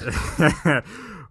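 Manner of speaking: big real laugh, falling away; loud burst of laughter. Real, not acted. Laughing at closing joke.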